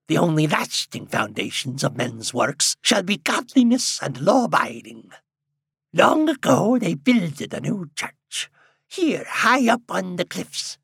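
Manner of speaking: put-on slow, solemn high old preacher; old man voice. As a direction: intone it like an old prophet.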